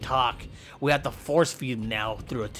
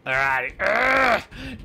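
high, slow straining grunt; 7 semitones higher, 3 dB louder, much slower than usual. Pretend. Wrestler grunting as he attacks in a high straining growl.

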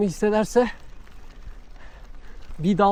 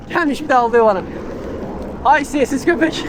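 mock scolding; 6 semitones higher, 4 dB louder than usual. Real, not acted. Rider jokingly insulting the dog that whined at him, mock scolding.

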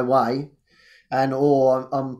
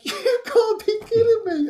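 much higher, squeaky incredulous; stunned disbelief. Retold or imitated. Hearing another thank-you, high amazed disbelief. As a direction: gasp it out in total disbelief. His stunned reaction retold.

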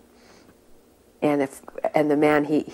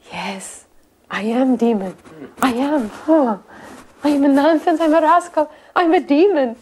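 confess, giving way more with each phrase; imitating a broken man; 8 semitones higher, 5 dB louder than usual. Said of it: "where he just broke".

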